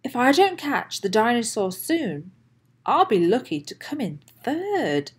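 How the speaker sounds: ordinary delivery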